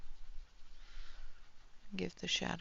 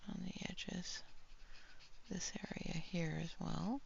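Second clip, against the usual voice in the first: whispering; 8 dB quieter than usual. Real, not acted. Quiet aside while painting.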